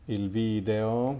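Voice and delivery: ordinary talk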